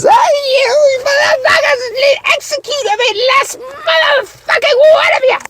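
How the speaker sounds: very high-pitched, drawn-out screech; crazed girlfriend shriek. Acted. Imitating the diner robber in the opening scene, crazed shriek. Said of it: "the crackhead girlfriend".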